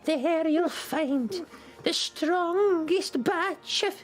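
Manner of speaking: put-on very slow, halting old seer; drawn-out cryptic directions, pausing often; mysterious old grandmother.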